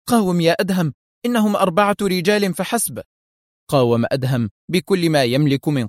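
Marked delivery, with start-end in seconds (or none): 0.1-3.0 high-pitched call to fight; urging cry. A narrator's reading. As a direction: cry out to spur him on. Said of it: "وهي تهتف". (هتاف للحث على المقاومة)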